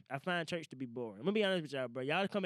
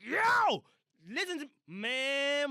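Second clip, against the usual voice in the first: high, loud hype call out of nowhere; thrilled, slow drawn-out yo; excited shout; 9 semitones higher, 5 dB louder, much slower than usual. Real, not acted. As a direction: yell it like the movie's tomorrow. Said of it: "I'm excited for this shit".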